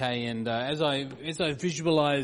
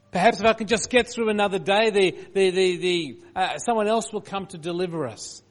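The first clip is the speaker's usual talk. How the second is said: higher, louder desperate pleading; desperate plea; 5 semitones higher, 7 dB louder than usual. Retold or imitated. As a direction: cry it out in desperation. Voicing besieged people's desperation.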